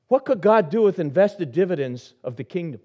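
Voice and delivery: high, loud preaching climax; preaching at full force. Acted. Pastor urges the congregation to read the psalm, fervent preaching. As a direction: lift the church with each line. Preaches about God's blessings.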